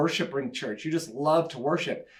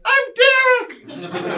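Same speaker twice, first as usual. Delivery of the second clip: very high, slow, louder squeak through a laugh; indignant high voice; 7 dB louder, much slower than usual. Retold or imitated. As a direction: squeak it out like a boy mistaken for a woman. Brother mistaken for woman on phone.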